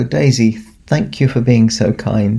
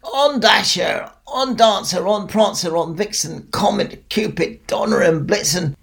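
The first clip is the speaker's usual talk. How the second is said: calling the reindeer; 8 semitones higher, 3 dB quieter than usual. Acted. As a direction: sing out the reindeer names. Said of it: "I called out to them".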